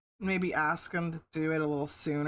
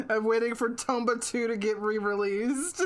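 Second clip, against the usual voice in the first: ordinary delivery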